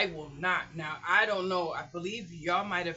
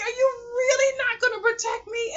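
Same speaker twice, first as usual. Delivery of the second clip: very high, loud incredulous wail; indignant protest; 8 dB louder than usual. Retold or imitated. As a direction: plead in outraged disbelief. Retold indignation at man.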